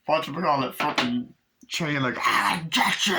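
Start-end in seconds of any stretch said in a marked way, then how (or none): 2.2-3.2 slow, strained, higher monster voice; demon threat imitation. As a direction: lunge at them as a playful demon. Imitates demon grabbing at victims.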